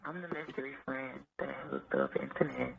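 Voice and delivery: soft sensual morning voice. Real, not acted. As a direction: talk in a just-woke-up morning voice. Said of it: "I got my morning voice on, so it's gonna sound a little sensual".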